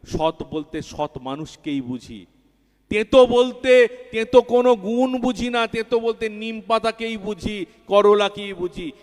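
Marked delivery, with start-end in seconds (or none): 2.9-8.9 raised, ringing high preaching voice; fervent preaching. Real, not acted. Preacher driving home a point to the congregation, ringing and raised. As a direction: lift it up and proclaim it.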